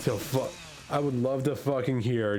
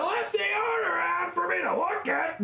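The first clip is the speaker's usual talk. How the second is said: high-pitched exasperated yell; sudden squealed shout of frustration; exasperated outburst. Real, not acted. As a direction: let the frustration burst out way up high. Exasperated wish about a coworker's slip.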